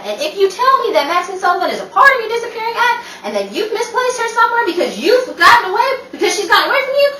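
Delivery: exasperated scold building up speed; rising scolding rant. Acted. Rachel scolding Mason, building.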